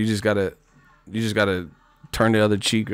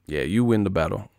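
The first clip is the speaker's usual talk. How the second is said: ordinary delivery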